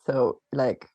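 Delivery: ordinary talk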